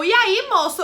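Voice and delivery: retelling her nervous loud "so, sir?"; impatient call. Retold or imitated.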